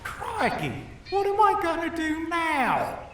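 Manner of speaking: hammed-up outback panic; panicked. Austin alone after the attack, high panicky voice.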